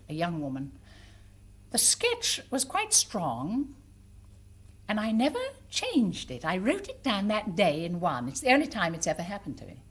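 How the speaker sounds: normal talking voice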